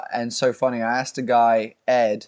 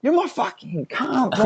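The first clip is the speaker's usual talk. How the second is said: put-on higher, laughing, affectionate Aussie accent; mock Australian accent; 9 semitones higher than usual. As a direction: say it like a friendly Aussie mate, laughing. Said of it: "it can be positive".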